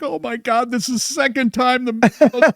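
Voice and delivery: high-pitched mock gushing; hammed-up falsetto excitement; gushing fan impression. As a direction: put on a squealy, over-thrilled fan voice. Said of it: "Patrick will be like".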